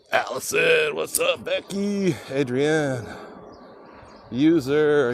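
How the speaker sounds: high, bright greeting; excited shout-out. Real, not acted. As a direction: call out names cheerfully. Live stream shout-outs to viewers.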